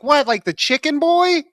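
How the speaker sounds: put-on bratty toddler sniping at his mom; sassy baby. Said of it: "And the baby's like".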